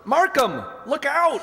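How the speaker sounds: shouted warning. Acted. Bookcase falling on man.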